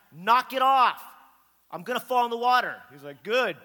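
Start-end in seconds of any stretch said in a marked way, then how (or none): 0.1-2.8 loud, high-pitched protest; annoyed yell. Retold or imitated. Yelling at brother from canoe. 3.2-3.6 loud, high one-word taunt; taunting shout. Retold or imitated. Brother taunts back from shore.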